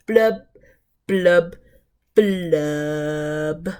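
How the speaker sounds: falling glum fish blubs; sad fish blubs. Acted. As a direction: let each blub droop down like a sigh. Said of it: "He's very, very sad".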